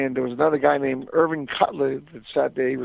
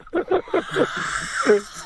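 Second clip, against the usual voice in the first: real burst of high laughter; high-pitched real laugh. Real, not acted. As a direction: crack up at the punchline. Laughing at his own story.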